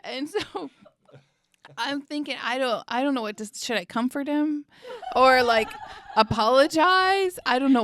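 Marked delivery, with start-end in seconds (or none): 5.2-7.8 louder, higher, laughing through it; laughing at her own awkwardness. Real, not acted. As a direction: giggle out the question.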